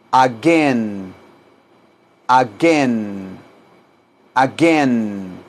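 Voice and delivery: "Again" low, drawn out and falling, hard g; pronunciation model. Shown as a demonstration. Teacher repeats "Again" for students, low, drawn-out second syllable. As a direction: stretch the end of "Again" after a hard g. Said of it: "La pronunciación de again".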